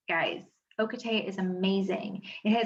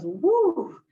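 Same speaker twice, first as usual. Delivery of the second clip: high, loud "Woo" of a frazzled brain; mock brain whoop; 8 semitones higher, 5 dB louder than usual. Scene: at the computer, her brain goes off, high loud whoop. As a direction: let out a sudden high whoop of overload. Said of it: "my brain goes".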